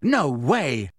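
yelled. A narrator's reading. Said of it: "yelled Harry".

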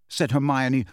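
ordinary delivery

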